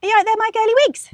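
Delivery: high, fast sing-song call across the garden; calling from far away. Real, not acted. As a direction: call out loudly to the hens. Calling out to the chickens.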